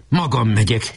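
ordinary delivery

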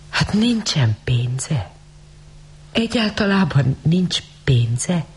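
hushed then rising high in disbelief; slow wondering query; astonished question. A narrator's reading. Rosemary asks the girl about money, astonished.

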